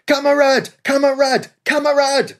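high, pleading repeated shouts; surrender cries. A narrator's reading. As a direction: beg not to be shot. Prisoners surrendering.

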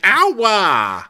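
bratty prince yelping after a slap, high and loud; hurt whiny prince. Retold or imitated. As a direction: yelp like a slapped bratty boy. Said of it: "And he's like".

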